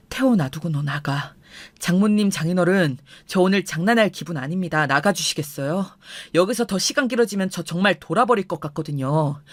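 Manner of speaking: low, firm ultimatum; icy restraint, pitch kept down; cold, controlled anger. Acted. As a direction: order them out with anger reined in. Husband coldly orders wife and in-laws out.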